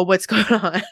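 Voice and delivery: asked through a laugh, half joking; laughing question. Retold or imitated. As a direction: giggle while you ask. Imagined friend asking about cleaning.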